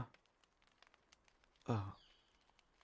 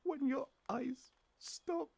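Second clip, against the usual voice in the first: distressed; 3 dB louder than usual. Acted. Confessing to the stranger they saw them die, voice breaking high. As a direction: voice climbing as it nearly breaks. Confessing witnessing their death.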